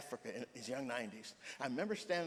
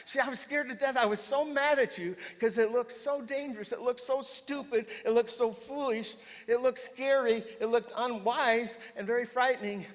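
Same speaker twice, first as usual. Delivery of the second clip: much higher and louder, each phrase hammered; impassioned loud retelling; 11 semitones higher, 9 dB louder than usual. Retold or imitated. Preacher voicing the woman scared by the stool, loud and high. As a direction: voice the churchgoer's alarm full out. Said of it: "And she says".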